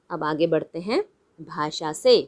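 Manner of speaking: ordinary talk, nothing marked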